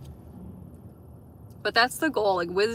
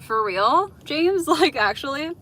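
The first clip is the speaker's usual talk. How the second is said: amazed disbelief; 7 semitones higher, 6 dB louder than usual. Real, not acted. Reacting to toilet paper story.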